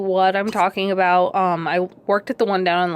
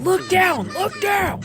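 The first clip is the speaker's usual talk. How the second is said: squeaky alarmed shout at the floor; imitating the guard calling look down; mock urgent guard; 12 semitones higher, slower than usual. Night guard pointing, calling out.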